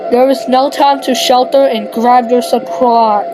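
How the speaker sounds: ordinary delivery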